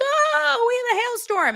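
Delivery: very high mock panic, held; shrill hammed-up hailstorm alarm; squeaky mock alarm. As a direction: squeak it like a cartoon fright. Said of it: "And they were like".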